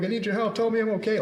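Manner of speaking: retold panicked scream, high and loud. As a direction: pitch leaps high, loud desperate cry. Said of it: "So I started screaming".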